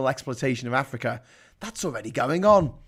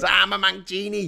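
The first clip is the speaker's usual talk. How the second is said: lightly voiced Mancunian genie, pitched up; Mank genie; 4 semitones higher than usual. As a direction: announce yourself as a genie popping out. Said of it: "this Mank genie comes out of it".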